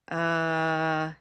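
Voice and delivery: hesitating "uh". Shown as a demonstration.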